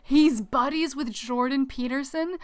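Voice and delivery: loud, slow, drawn-out dismay; appalled disbelief. Real, not acted. Streamer learning who his buddies are, appalled. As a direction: stretch the words out in real horror. Appalled at further news from chat.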